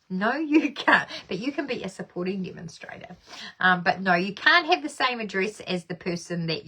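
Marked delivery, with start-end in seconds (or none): 0.1-1.1 laughing. Real, not acted. Repeats answer, laughing.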